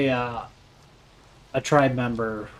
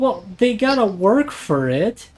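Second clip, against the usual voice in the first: mock heartless; 8 semitones higher, 6 dB louder than usual. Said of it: "You sound awful".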